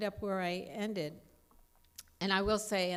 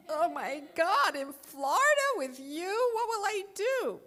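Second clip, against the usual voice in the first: high, loud, slow squeal of dismay; much higher pitched, dragging mock panic; horrified inner voice; 12 semitones higher, 7 dB louder than usual. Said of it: "And I thought".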